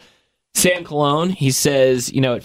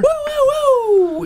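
very high-pitched whoops; excited whooping; 3 dB louder, much slower than usual. Real, not acted. Whooping for Super Bowl Sunday.

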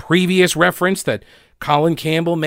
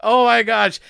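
ordinary delivery

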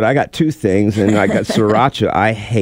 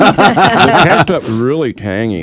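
laughing; 4 semitones higher, slower than usual. Real, not acted. Laughing describing taste.

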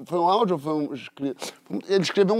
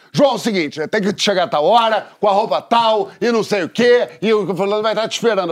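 raised, insistent imitation of accelerated audio; pushy, higher-pitched mimicry of a sped-up message; 4 semitones higher, 4 dB louder than usual. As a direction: fire it off like audio on fast-forward. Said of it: "quando eu acelerei, era assim".

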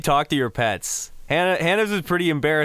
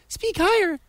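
much higher pitched demo voice. Shown as a demonstration. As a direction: shoot the voice way up high. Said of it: "go higher up".

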